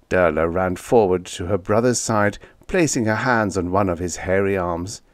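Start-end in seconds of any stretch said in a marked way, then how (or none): none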